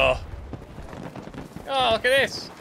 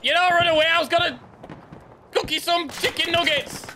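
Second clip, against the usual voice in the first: mock friendly yell; 9 semitones higher than usual. Teasing a fleeing enemy.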